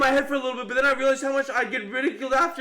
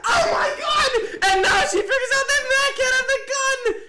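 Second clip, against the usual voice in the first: much higher, loud shocked cry; shocked outcry; 9 semitones higher, 3 dB louder than usual. Real, not acted. Streamer realizing who has the gun, shocked outcry. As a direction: blurt it out as the realization hits. Sudden realization during a game.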